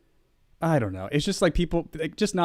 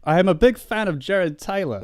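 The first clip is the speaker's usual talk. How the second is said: slipping into Australian; 6 semitones higher, 3 dB louder than usual. Pretend. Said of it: "slipping into Australian now".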